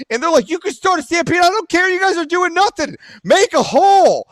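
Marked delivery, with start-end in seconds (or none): 0.5-3.0 high-pitched heated exchange; raised, defiant voice; retold quarrel. Voices of protest and retort. 3.2-4.3 high, loud call; re-enacted forceful holler; crowd-clearing shout.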